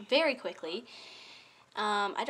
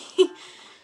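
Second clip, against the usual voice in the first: short breathy laugh; 11 dB louder than usual. Real, not acted. Laughs after plea.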